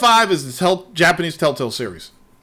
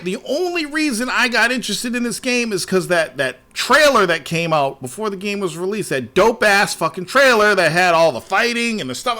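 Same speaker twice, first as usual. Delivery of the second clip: ordinary delivery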